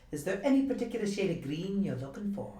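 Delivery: fast, put-on Irish, rattled off; hammed-up bad Irish shopkeeper line; atrocious Irish accent. Co-host doing her go-to atrocious Irish accent. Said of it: "the one phrase that I always use".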